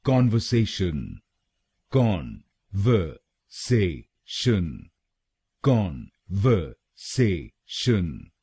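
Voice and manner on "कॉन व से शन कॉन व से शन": कॉन व से शन broken into separate syllables, very slowly; slow syllable drill. Shown as a demonstration. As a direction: spell out कॉन व से शन one syllable at a time, very slowly. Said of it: "तो बनेगा conversation".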